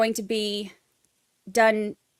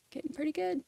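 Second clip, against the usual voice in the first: softer and higher to self; quiet higher aside; 6 semitones higher, 12 dB quieter than usual. Real, not acted. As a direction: mutter it under your breath, higher. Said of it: "I'm getting pretty good at it".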